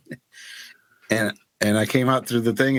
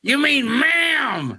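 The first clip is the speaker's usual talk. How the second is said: mock smoker's voice, offended; 12 semitones higher, much slower than usual. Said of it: "So she had that smoker's voice".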